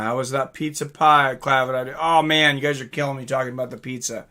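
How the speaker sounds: ordinary talk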